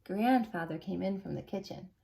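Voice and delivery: ordinary delivery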